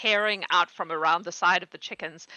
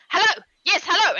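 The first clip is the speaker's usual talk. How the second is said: high, loud eager goblin hello; eager goblin greeting; 8 semitones higher, 5 dB louder than usual. Acted. Goblin guard Urks greeting the visitors, high and loud. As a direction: greet them like an overexcited little goblin. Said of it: "and he's like".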